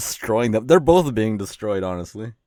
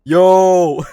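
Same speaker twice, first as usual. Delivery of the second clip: startled yell; 9 semitones higher, 11 dB louder than usual. Real, not acted. Reacting to the insult.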